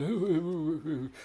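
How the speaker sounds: imitating a moaning character with a mocking ooh; whiny posh mimicry. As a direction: whine like a pampered upper middle class man. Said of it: "this whiny, big, upper middle class guy".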